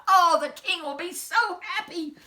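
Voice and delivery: high, loud burst of joy; picture the king's joy and cry out; delighted cry. Acted. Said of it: "he cried".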